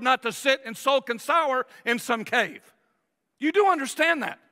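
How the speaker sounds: ordinary delivery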